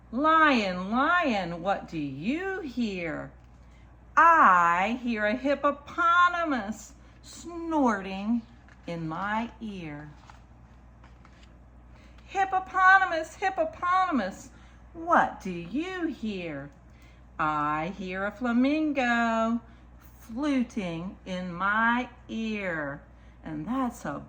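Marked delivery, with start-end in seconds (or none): 0.1-10.1 slow, lilting picture-book read; reading to children. Acted. Storyteller reads a picture book to children, lilting. 12.2-23.0 slow, lilting picture-book read; reading to children. Acted. Storyteller reads a picture book to children, lilting.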